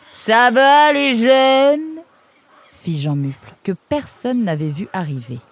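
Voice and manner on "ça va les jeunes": loud, high, very drawn-out greeting; hearty newcomer. Acted. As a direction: boom it out slowly to the youngsters. Jean Mufle surgit et interpelle. (salutation tonitruante et traînante)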